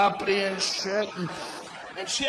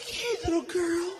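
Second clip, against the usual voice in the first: high put-on new-mother voice; mimicked mother greeting her baby; imitating cooing wife; 8 semitones higher than usual. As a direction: coo high to a newborn. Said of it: "She's like".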